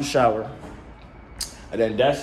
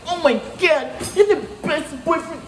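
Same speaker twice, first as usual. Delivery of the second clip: mocking girlfriend. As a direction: gush like an overjoyed girlfriend, way up high. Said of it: "He's like".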